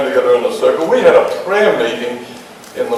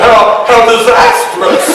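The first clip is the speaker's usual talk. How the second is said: mock horror; 7 semitones higher, slower than usual. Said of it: "She didn't realize I was making fun".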